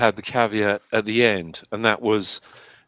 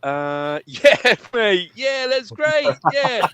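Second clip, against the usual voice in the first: high-pitched, baffled playing along; clueless agreeing; 11 semitones higher, much slower than usual. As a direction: agree politely without understanding a word. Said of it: "And I'm like".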